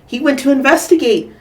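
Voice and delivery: ordinary delivery, nothing marked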